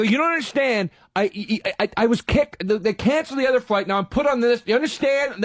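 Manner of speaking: high, worked-up mock yell; retold screaming. Passenger explaining a canceled flight to desk lady, mock screaming. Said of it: "screaming like that".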